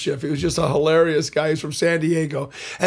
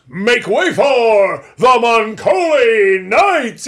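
slow, booming announcer shout, much louder and higher; big announcer boom; 4 semitones higher, 6 dB louder, much slower than usual. Retold or imitated. The professor's final cue, a slow booming shout. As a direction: bellow it like a trailer voice. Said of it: "It had to be like one of those big boom".

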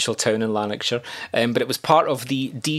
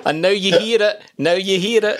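high, louder teasing imitation; mock club catchphrase; 8 semitones higher, 4 dB louder than usual. Host mimics the Wishaw clubbing crowd, high and playful. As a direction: call it out high, playfully hammed-up.

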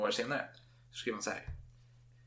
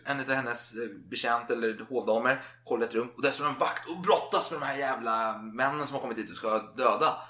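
ordinary talk, nothing marked

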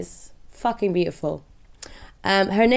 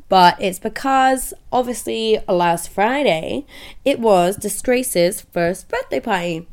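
usual speaking voice

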